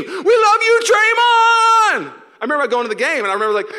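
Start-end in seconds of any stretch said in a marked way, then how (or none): 0.0-2.1 very high, loud crowd scream; hammed-up fan devotion at full volume; adoring fan scream. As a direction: shriek love at your star player. Voices adoring fan at game.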